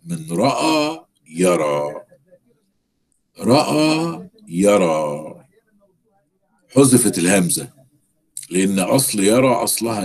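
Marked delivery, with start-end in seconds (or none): 0.1-5.4 each word drawn out slowly for the class; slow dictation. Shown as a demonstration. Teacher slowly dictating رأى يرى to the class. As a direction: spell it out slowly so students can follow. Teacher spells out verb forms.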